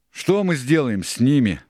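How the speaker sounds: high, loud eager-hand question; Dick, questioning. A narrator's reading. Dick asks the pirates.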